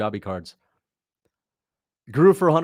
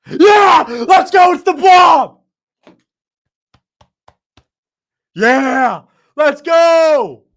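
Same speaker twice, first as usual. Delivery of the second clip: hype shout; 12 dB louder, much slower than usual. Acted. Hyped cheering for the Blob.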